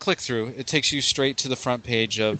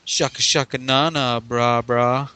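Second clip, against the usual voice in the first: laid-back Californian surfer voice, calling everyone brah; surfer accent. Pretend. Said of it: "the same accent to us over here".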